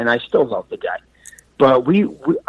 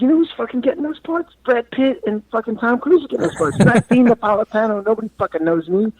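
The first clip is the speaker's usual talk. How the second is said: high-pitched exasperated retold rant; heated rant; 11 semitones higher than usual. Arguing with his actor friend about auditions, exasperated high rant. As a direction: vent it like a drunk street argument. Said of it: "we got into the biggest fight".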